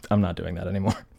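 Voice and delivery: usual speaking voice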